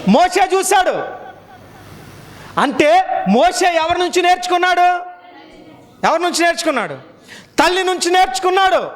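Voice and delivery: high-pitched, sustained sermon delivery; urgent exhortation held high; forceful preaching. Real, not acted. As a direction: preach it out, driving each clause home.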